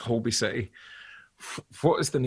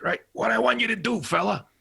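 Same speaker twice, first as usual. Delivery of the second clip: brash director giving orders, pitched up; emphatic, higher-pitched director impression; John Landis; 5 semitones higher than usual. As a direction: pitch the scene big, imitating the director. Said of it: "he was like".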